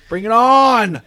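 loud, high rallying shout; hyped battle cry. Real, not acted. Friend cheers on the start of the draft, hyped shout.

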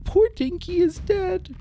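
mournful little creature voice; mildly tearful sidekick grieving his friend; Bramble crying. Acted. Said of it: "Bramble started to cry".

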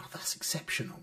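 lightly voiced little girl, hushed; a hint of a prim child; imitating a child. Dad quotes his young daughter.

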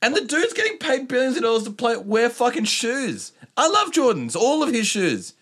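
loud, high disbelieving rant about player pay. Real, not acted. Podcaster ranting to cohosts about player pay, high and loud.

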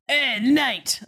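hammed-up thick accent, high and drawn out; Mark Wahlberg. Host imagines the miscast actor hailing the hero, hammed-up thick accent. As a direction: call out loudly in a mock thick accent. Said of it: "Mark Wahlberg and a very thick fucking accent".

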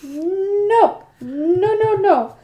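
high, loud protesting no's; horrified refusal. Real, not acted. Listener hearing awful details, mounting loud refusal. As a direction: build to a big shouted no.

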